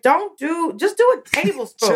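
high, lightly voiced bossy sister; bossy big sister. Big sister correcting her brother's sugar, lightly bossy. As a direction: stop him mid-pour. Bossing little brother in kitchen.

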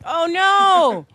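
high, loud mock dismay; surprised cry. Real, not acted. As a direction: yelp it, caught out. Caught out by the trick.

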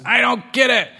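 high, grumpy little kitten. Acted. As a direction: sulk like a kitten. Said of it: "they're very grumpy looking".

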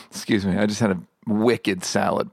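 normal talking voice

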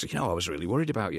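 ordinary delivery

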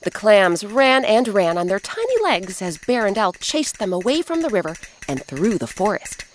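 ordinary delivery

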